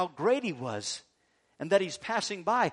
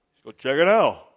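brave front; 3 semitones lower, 11 dB louder than usual. Acted. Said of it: "you put on a good front".

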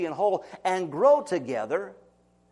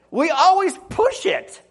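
slow, higher and louder push; emphatic; 10 semitones higher, 6 dB louder than usual. Real, not acted. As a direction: lean hard on it. Preacher stresses human nature.

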